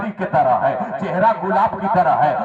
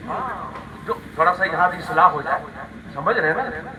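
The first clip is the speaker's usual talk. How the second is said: ordinary delivery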